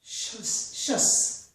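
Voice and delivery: "shus" said slowly with a clear sh onset; shus ending sound. Shown as a demonstration. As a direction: sound out "shus" as sh-us, unvoiced and crisp. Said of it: "These endings all sound the same".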